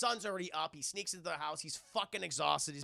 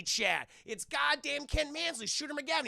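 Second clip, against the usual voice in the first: ordinary talk, nothing marked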